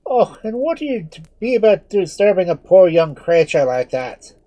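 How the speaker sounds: Father Murphy. Acted. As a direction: protective priest scolding the mob. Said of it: "cried the well-known voice of Father Murphy".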